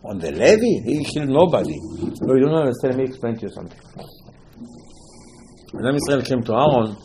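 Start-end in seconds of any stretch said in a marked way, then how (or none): none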